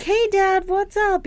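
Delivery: high-pitched chirpy hello; phone greeting. Retold or imitated. Daughter phoning her deaf dad, high-pitched greeting. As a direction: greet a hard-of-hearing parent up high.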